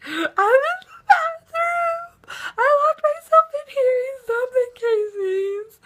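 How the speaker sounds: very high, slow squealed whimper; acted panicked whimper. Hiding in the bathroom calling friend, mock tearful panic. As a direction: squeak it out as if near tears, hammed up.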